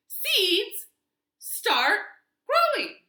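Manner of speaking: loud, high-pitched insistent order. A narrator's reading. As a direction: call each word out loud and high. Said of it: "So Toad said it louder".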